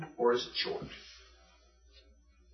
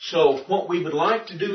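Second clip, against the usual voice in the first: ordinary talk, nothing marked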